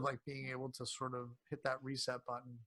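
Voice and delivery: ordinary talk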